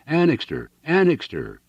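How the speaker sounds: high-pitched call of a name; urgent shout. A narrator's reading. Gaunt fugitive shouting for Annixter, urgent shout. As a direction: cry out for your friend. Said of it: "and again".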